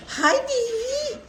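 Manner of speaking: high, slow cooing. Real, not acted. Baby talk to animal.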